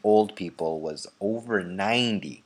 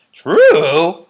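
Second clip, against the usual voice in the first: high loud incredulous cry; all four grandparents crying out at once; loud shocked old voices; cried out; 8 semitones higher, 14 dB louder than usual. A narrator's reading. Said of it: "cried all four of them at once".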